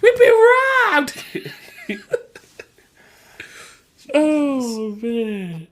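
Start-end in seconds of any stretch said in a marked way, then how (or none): 0.0-1.2 much higher, louder, laugh-cry shriek; hammed-up high-pitched wail; mock scream. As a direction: scream it in cartoonish panic. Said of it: "the way Steve screamed".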